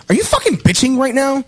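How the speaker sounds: high, heated mock confrontation; retold angry snap. Bartender snapping at the server over a lemonade, heated and high. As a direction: fire the question at her, pitched high.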